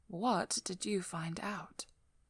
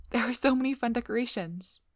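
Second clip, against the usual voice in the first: higher, louder gush over the room; excited, pitched-up enthusiasm; bubbly admiration; 6 semitones higher, 8 dB louder than usual. Acted. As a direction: coo over a date's home with delight. Admiring the date's home.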